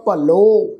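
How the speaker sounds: ਭਲੋ with a loud, full final "o"; ਭਲੋ said "bha-lo", the o held; kanora vowel sounded. Shown as a demonstration. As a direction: land firmly on the "o" of ਭਲੋ. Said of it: "ਕਨੋੜੇ ਦੀ ਮਾਤਰਾ ਲਗਾ ਕੇ ਪਾਠ ਉਚਾਰਨ".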